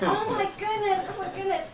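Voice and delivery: high, breathless mock panic; panicked. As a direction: squeal it in rising dread. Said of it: "And I'm like".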